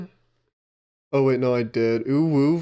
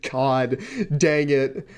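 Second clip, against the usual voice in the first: ordinary talk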